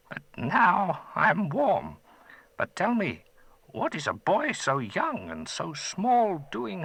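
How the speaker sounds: put-on a gentle old wizard, curious; old magician. Old man thanking the boy who freed him, gentle.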